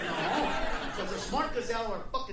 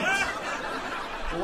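ordinary delivery

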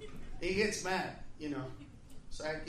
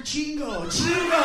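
defiant demand; 5 semitones higher, 8 dB louder, slower than usual. Acted. Friend demands lots of chili.

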